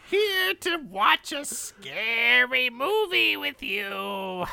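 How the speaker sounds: high, slow spooky horror host; drawn-out creepy uncle voice; Uncle Tallywhacker. Acted. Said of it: "You tried".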